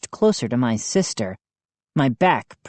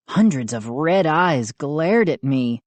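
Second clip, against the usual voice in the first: usual speaking voice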